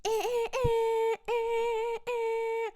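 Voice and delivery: high, slow honking noises; car horn imitation. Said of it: "I start honking my horn".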